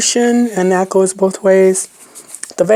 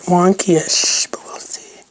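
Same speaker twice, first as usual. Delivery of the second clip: whispering; 2 semitones lower, 6 dB quieter than usual. Real, not acted. Muttering to herself while crafting.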